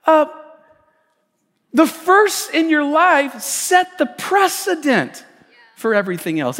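loud, building sermon delivery; preaching. Acted. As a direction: press the point to the congregation. Preaching with rising force.

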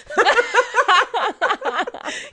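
high, helpless burst of laughter; long high-pitched giggle fit; laughing at a slip. Real, not acted. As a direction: crack up high at your own mistake. Laughs at own slip over handle.

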